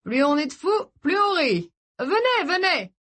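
high, bubbling invitation; party host in high spirits; exuberant host. Acted. Host waving guests in.

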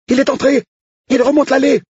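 very high, excited squeal of a voice; piercing high voice, breathless with excitement; shrill excited cook. Acted. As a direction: pitch the cook way up in a giddy squeal. Odile live commentary.

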